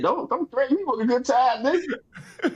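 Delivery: high-pitched comic delight, laughing at the end; retold giddy acceptance; playful eagerness. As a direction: jump at the offer in a high voice. Retells jumping at Vegas offer.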